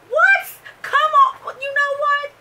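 high, loud exasperated cry; worked-up outburst. Real, not acted. Vlogger ranting at the husband's demand, high and loud. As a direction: blow up in high, loud disbelief. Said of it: "I'm going too far".

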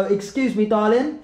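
high-pitched Essex accent; British accent. Shown as a demonstration. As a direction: model an Essex accent for a learner. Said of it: "your British accent".

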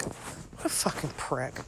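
muttered disgusted whisper; whispered insult. Real, not acted. Insulting the boyfriend, whispered. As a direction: spit it under your breath.

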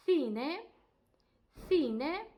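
cine with a lisped th at the start; Spanish c as th. Shown as a demonstration. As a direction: say cine as thine, tongue between the teeth. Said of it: "Наприклад, у таких словах".

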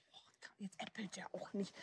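whispered aside to herself; whispering. Real, not acted. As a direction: breathe it under your breath. Muttering to herself on horse.

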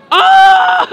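high, loud scream; sudden high-pitched yell; startled scream. Real, not acted. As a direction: scream out before cracking up. Sudden outburst, then laughing.

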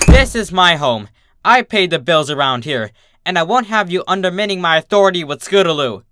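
loud, fast angry rant; furious tirade. Acted. Mom raging at dad about her authority, loud furious rant. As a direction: lay down the law, shouting.

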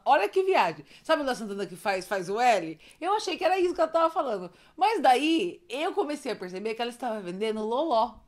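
ordinary talk, nothing marked

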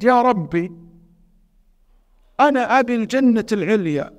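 Arabic accent, lightly imitated old woman; grandmother's Arabic accent. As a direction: imitate her Arabic accent calling out to God. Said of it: "in her accent".

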